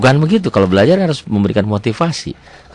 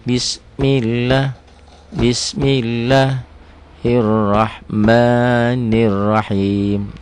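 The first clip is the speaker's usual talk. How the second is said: drawn-out chanted basmala; slow recitation; 3 semitones higher, much slower than usual. Shown as a demonstration. Teacher reciting the basmala as an example, slow chant. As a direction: recite the basmala slowly in tilawah.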